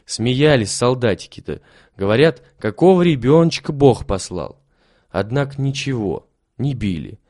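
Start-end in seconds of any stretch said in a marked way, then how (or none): none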